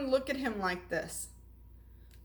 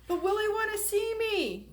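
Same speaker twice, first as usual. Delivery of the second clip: high, pleading little voice; worried, reaching up in pitch; anxious cry; 10 semitones higher than usual. Acted. Punchinello calls after Lucia, higher and anxious. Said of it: "cried Punchinello".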